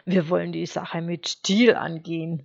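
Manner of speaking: ordinary delivery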